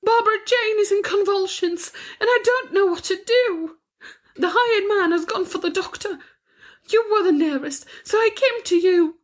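very high, panicked wail; distraught, tearful pleading. A narrator's reading. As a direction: plead desperately for the baby's life. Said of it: "wringing her hands and sobbing".

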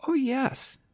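high, eager little puppy; a lost small pup, chirpy and sweet; yappy panting puppy. Acted.

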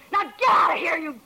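shouted command to get out; furious showgirl ordering the detective out; angry order. Acted. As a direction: throw him out with a shout. She orders him out.

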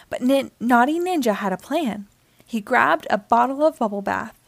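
plain reading voice

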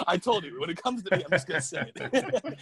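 amused, laughing at herself. Real, not acted. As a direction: giggle out the words. Laughing after remembering name.

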